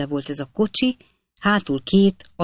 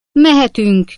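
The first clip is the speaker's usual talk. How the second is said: loud, higher call to set off; shouting; 7 semitones higher, 8 dB louder, slower than usual. A narrator's reading. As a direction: call out the start. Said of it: "kiáltotta a lovászfiú". (hangos kiáltás)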